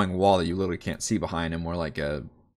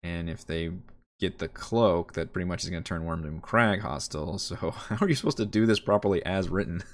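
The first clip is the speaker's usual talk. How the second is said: normal talking voice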